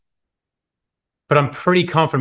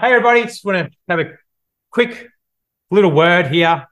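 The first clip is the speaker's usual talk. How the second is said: higher, fast, upbeat address to a carriage of strangers; tram speech opener; 4 semitones higher, 4 dB louder than usual. Shown as a demonstration. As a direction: grab the whole tram's attention at once. Addressing tram passengers, speech demo.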